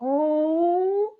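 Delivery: high, drawn-out "oh" of delight; light up at an adorable sight; delighted coo. Acted. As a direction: melt into a long, high-pitched coo. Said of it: "their face lights up where they're like".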